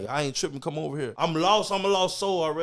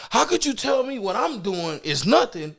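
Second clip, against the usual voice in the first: ordinary delivery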